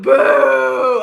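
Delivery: very loud, long boo; hammed-up crowd boo. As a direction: jeer like a whole arena. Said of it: "The crowd".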